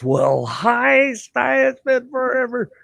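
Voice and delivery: very high-pitched mock greeting; effusive greeting. Old friend greeting him after many years, effusive. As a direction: imitate an old friend's big hello.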